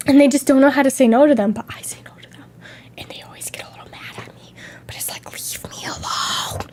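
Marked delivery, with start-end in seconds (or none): 1.5-5.2 unvoiced conspiratorial whisper; hushed whispered aside. Real, not acted. 5.3-6.6 unvoiced slow whisper of Leave me alone; hushed plea "Leave me alone". Whispered take.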